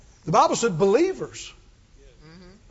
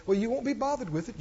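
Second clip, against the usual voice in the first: ordinary talk, nothing marked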